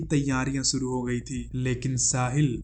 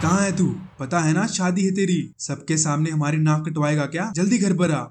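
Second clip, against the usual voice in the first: louder, higher angry scolding; father shouting; 3 semitones higher, 4 dB louder than usual. Retold or imitated. Father scolding son on the phone, shouting. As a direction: yell at the runaway son. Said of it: "एकदम चिल्ला कर बोले".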